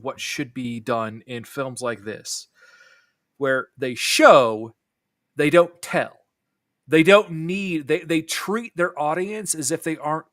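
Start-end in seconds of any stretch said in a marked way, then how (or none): 3.4-7.9 loud slow emphasis; emphatic stress. Real, not acted. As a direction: hammer each phrase. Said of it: "emphatic point".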